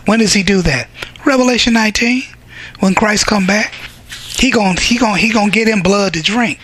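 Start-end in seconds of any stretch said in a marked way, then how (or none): none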